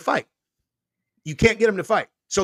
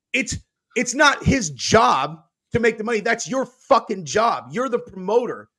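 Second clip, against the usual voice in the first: raised, higher shouted rant as Dana; mock angry rant; 6 semitones higher than usual. Imitating Dana yelling at the press about the promoter. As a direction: rail at the reporters, mocking Dana. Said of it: "He's like".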